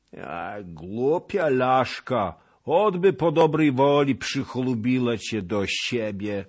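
high, loud, slow scolding hag; scornful witch. A narrator's reading. Witch mocking the Pole who spurns the Cossack. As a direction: sneer like an old witch.

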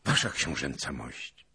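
usual speaking voice

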